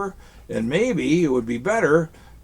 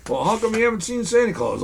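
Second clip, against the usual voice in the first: puppet voice; 7 semitones higher, faster than usual. Pretend. Puppet dialogue with dragon.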